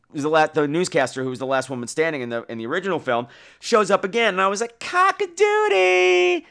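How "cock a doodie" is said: high, drawn-out prim outrage; mock Annie Wilkes squeal; Kathy Bates in Misery. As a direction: squeal it slowly like a prissy fanatic. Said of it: "like Kathy Bates in Misery".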